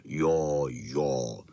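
very low dog voice; deep, brief big-dog assent; big St. Bernard. A narrator's reading. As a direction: rumble agreement from down low. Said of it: "říkal Bernardin".

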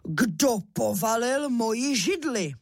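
slow, drawn-out papa bear outcry; storybook father bear, indignant; strong bear voice. A narrator's reading. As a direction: demand it slowly as the big bear. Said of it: "rozkřičel svým silným medvědím hlasem".

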